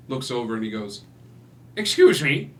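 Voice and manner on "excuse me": high, loud little man's "excuse me"; a small man bristling at the intruders; indignant call. Acted. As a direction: pipe up sharply like a tiny indignant man.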